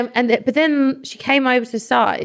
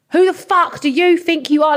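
pitched up, pushy, in-your-face; mock aggressive shout; 8 semitones higher than usual. Drunk woman squaring up to the manager, shouting. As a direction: throw it right in her face. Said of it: "really getting in my face".

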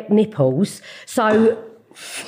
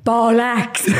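a lightly voiced Welsh auntie spitting bollocks; a lightly voiced Welsh aunt swearing; imitating auntie's Welsh. Mum imitating her auntie swearing, lightly voiced. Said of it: "my auntie Jean ... she went".

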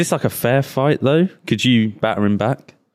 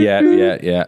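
ordinary delivery

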